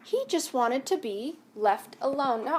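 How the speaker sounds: ordinary delivery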